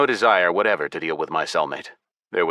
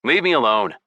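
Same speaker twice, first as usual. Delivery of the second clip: mild shout; 4 semitones higher, 3 dB louder than usual. A narrator's reading. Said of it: "and shouted".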